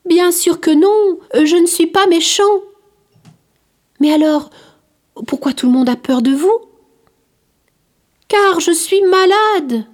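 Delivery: put-on a kind outcast reassuring a curious child; gentle leper. The leper tells the boy Curius why people flee, gently insisting. Leper explains his illness.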